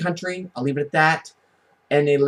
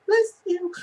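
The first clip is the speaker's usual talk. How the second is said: mock polite bless you. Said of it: "I'm like".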